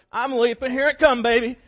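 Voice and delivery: loud, high whoop of a boast; hyped groom. Acted. Groom jumping out of the truck, loud and high. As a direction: bound in shouting it.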